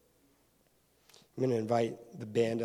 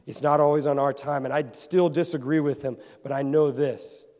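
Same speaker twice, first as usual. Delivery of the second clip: louder, higher preacher's declaration; preaching with force; 3 semitones higher, 10 dB louder than usual. Acted. Pastor declares his faith to the congregation, loud and higher. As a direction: proclaim it to the room. Sermon rises to conviction.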